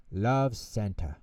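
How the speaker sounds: usual speaking voice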